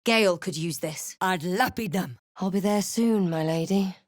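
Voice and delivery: ordinary delivery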